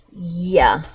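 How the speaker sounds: usual speaking voice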